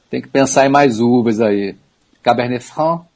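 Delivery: usual speaking voice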